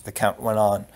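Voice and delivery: plain reading voice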